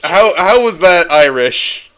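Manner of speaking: high, loud, slow disbelief; mock outrage at a bad accent; incredulous. Real, not acted. Friend mocking the Irish accent, loud incredulous laugh.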